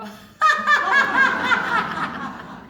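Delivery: very loud high burst of laughter; pointing self-laugh. Shown as a demonstration. Teacher points at herself and bursts out laughing, very loud. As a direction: laugh hard at yourself. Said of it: "So we're gonna go".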